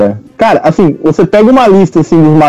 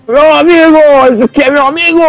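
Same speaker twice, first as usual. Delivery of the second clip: higher voice, playful con-man impression; high-pitched imitation; 9 semitones higher than usual. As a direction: put on the con man's voice.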